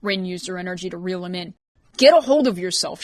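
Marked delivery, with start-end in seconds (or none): 2.0-3.0 high, loud cry to snap him out; a sharp loud call at Sam. A narrator's reading. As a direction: shout him back to his senses. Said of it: "she cried".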